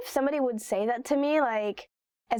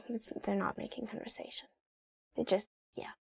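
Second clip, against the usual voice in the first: conspiratorial whisper to the viewer; whispered aside; 10 dB quieter than usual. Real, not acted. To viewers, whispering the real motive. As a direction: drop to a whisper, as if confiding. Aside debunking the casual excuse.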